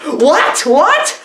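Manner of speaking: hammed-up double take at the jump cut; mock disbelief. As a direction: act stunned by the jump to a play.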